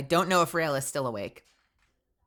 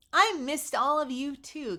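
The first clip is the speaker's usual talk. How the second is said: high, slow and sweet; 11 semitones higher, slower than usual. Real, not acted. Greeting viewer fondly.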